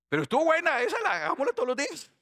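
high, loud delighted squeal; acted thrill. Disciple begging Jesus to repeat the storm, high excited acting. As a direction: gush like just off a wild ride.

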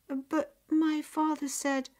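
high, slow, hesitant objection; tentative high-pitched protest; protesting girl. A narrator's reading. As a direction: start to explain in a small girl's high voice. Girl protesting, cut off by mother.

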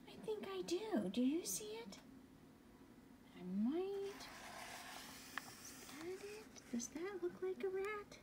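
high excited. Real, not acted. Excited search with child.

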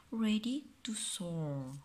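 ordinary delivery

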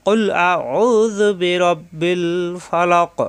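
slow, drawn-out melodic tilawat; Quran recitation. Acted. Teacher reciting the verse to students, slow chant. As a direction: recite it slowly in tajweed chant.

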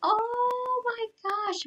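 high, stretched-out squeal; amazed. Real, not acted. Streamer sees the roller coaster, amazed. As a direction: draw it out high in wonder.

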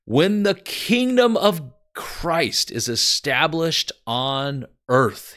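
high, loud and slow, like a preacher declaiming; proclaimed quote. Retold or imitated. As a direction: ring it out slowly as a grand prophecy. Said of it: "and I quote".

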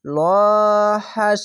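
লহাস chanted with a long, thick first vowel; slow recitation of লহাস, first syllable held; stretched, heavy vowel. Shown as a demonstration. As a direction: draw out the "lo" of লহাস. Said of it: "ওপরে আসলে মোটা করা হবে".

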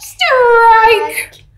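loud, high, stretched-out call; umpire's yell. Acted. Teacher plays umpire to children's pretend swings, yelling. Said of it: "the umpire would yell".

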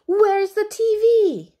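high, loud cross shout; furious storybook sister yelling; yelled demand. Acted. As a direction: yell the question at a brother. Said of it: "yelled Mary".

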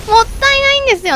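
もったいないんですよ said high and fast, hitting た; rushed, rising exclamation of もったいないんですよ; excited. Real, not acted. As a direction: blurt もったいないんですよ quickly and high. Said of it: "なんかもったいないんだよね".

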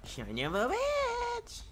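high, slow squealed curse; drawn-out squeal. Real, not acted. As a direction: stretch it out in a squeaky wail. Game frustration outburst.

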